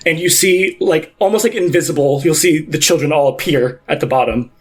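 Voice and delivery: usual speaking voice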